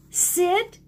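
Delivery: "Sit" sung out high as a hopeful command; eager command. A narrator's reading. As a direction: coax the dog to sit. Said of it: "said Ben".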